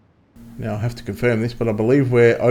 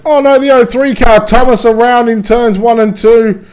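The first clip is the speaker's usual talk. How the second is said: sudden alarmed race call, higher; excited; 7 semitones higher, 5 dB louder than usual. Real, not acted. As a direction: crash call, voice jumps up. Crash call in race commentary.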